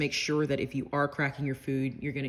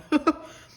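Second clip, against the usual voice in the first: sudden loud laugh; burst of laughter; 12 semitones higher, 8 dB louder than usual. Real, not acted. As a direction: laugh out at the heat. Laughing at too much spice.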